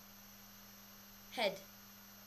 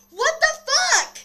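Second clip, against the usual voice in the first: very high, drawn-out mock scream; retold full-volume shriek; shocked scream; 15 dB louder, slower than usual. Acts shock at creatures running at her.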